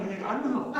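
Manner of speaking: ordinary talk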